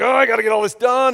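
groaning, high and loud, stressed out; strained mock groan of pressure; tense. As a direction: imitate frantic holiday overwhelm. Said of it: "So all that Christmas tension".

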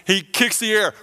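ordinary talk

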